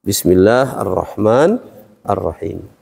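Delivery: drawn-out Quranic recitation of "Bismillahirrahmanirrahim", each syllable stretched; slow recitation. Shown as a demonstration. Teacher reciting bismillah slowly for the class. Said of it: "Likasratil istimal karena banyak digunakan".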